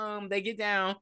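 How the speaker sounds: ordinary talk, nothing marked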